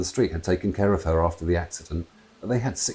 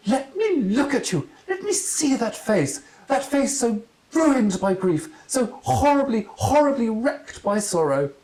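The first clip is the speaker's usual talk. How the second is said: slow, high, overwrought lament; hammed-up tragic wail; melodramatic aunt; slower than usual. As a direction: wallow in her grief theatrically.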